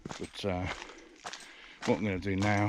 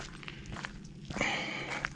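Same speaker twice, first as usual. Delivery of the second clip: long unvoiced sigh; 7 dB quieter than usual. Real, not acted. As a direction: let out a weary sigh. Sighs about time lapse.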